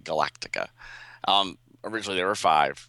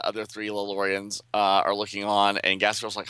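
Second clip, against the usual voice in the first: normal talking voice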